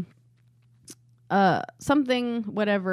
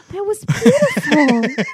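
slow, higher, laughing touched tone; mock awe; 10 semitones higher, 5 dB louder, slower than usual. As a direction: pretend to be deeply moved. Family moved by Spanish phrase.

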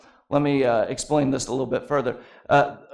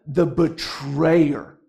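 slow, drawn-out dramatic reveal; ominous title, very slowly; much slower than usual. Acted. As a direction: stretch out "The betrayer" for drama. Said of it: "this is the most dramatic way".

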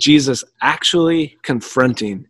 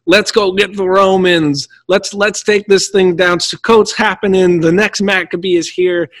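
high, excited rallying cry; eager crowd cheer; 5 semitones higher than usual. Retold or imitated. Palm-waving crowd cheering for revolt, high and eager. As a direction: pump the crowd up, higher pitched. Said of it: "you're like".

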